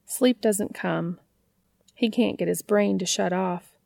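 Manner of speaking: usual speaking voice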